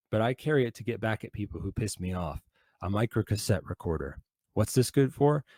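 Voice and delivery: usual speaking voice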